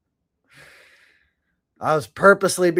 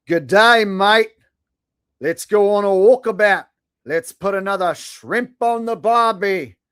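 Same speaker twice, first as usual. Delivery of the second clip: hammed-up Aussie, higher and slow; mock Australian accent; 5 semitones higher, slower than usual. Streamer mocking an Australian viewer in a fake Australian accent. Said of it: "I'm speaking your native tongue".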